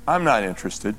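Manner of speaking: low, mild, matter-of-fact brush-off; nicely said refusal. Retold or imitated. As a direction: turn it down politely, pitched low. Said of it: "They just nicely said".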